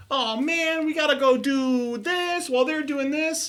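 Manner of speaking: high, squeaky mock anime character voice; mock anime line. Voice actor mocking his own character line, high and squeaky. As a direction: toss off a high cartoon line.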